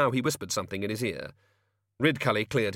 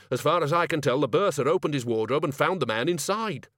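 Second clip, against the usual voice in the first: higher-pitched explaining wizard; lightly played academic giving his account; lightly voiced Dean; 6 semitones higher, 4 dB louder than usual. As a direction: report it in a raised character voice.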